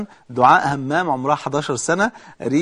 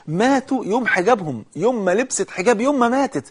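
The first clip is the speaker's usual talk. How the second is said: emotional climax; 7 semitones higher than usual. Real, not acted. Moving story of girls who died.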